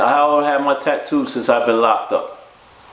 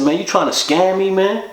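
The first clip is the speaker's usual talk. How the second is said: higher, sharp accusing challenge; heated accusation; 9 semitones higher than usual. Retold or imitated. Narrator accusing Mike of scamming him, heated and higher. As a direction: throw the charge right at him.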